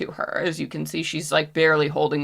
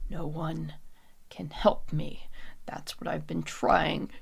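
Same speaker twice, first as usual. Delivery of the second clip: despairing whisper; 6 dB quieter than usual. Acted. Jada refusing help, hushed despair. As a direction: let it fade into a whisper. Despairing character answers a plea.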